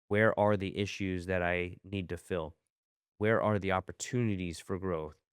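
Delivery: ordinary talk, nothing marked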